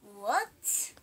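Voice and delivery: low, soft dad voice rising at the end. Acted. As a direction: imitate a dad with a low questioning word.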